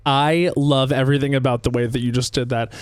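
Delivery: ordinary talk, nothing marked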